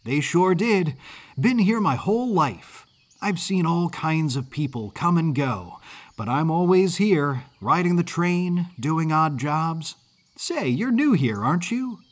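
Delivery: put-on high-pitched, eager Northern kid; bright-eyed young mid-Atlantic lad; exuberant Yankee boy. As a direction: chatter proudly in a high boyish voice. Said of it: "accent of a mid-Atlantic Yankee".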